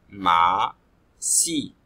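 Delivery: "Ma", "Si" said as separate punched syllables; teacher sounding out "Ma" then "Si" to class; syllables one by one. Shown as a demonstration. Beats "Ma", "Si" apart.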